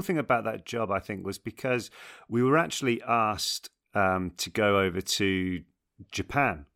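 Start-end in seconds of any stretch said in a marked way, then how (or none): none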